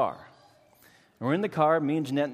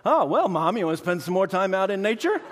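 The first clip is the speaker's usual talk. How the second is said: higher, louder teasing voice with a laugh; cheeky son; 6 semitones higher, 5 dB louder than usual. Retold or imitated. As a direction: needle Mom with mock enthusiasm. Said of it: "takes the opportunity to say".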